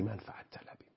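whispered aside; whispered punchline of the advice. Real, not acted. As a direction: drop to a breathy confiding whisper. Aside confiding a key point.

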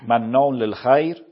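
slow, loud Quranic recitation of مناع للخیر with a stretched مناع. Shown as a demonstration. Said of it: "قرآن میگه".

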